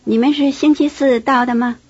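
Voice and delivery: ordinary talk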